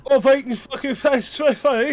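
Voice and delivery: mock British hooligan. Friends mocking British soccer hooligans with a slow loud yobbish shout. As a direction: bellow it like a football thug.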